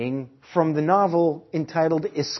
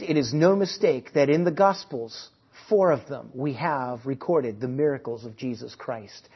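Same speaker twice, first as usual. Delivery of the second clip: usual speaking voice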